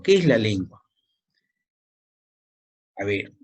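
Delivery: ordinary talk, nothing marked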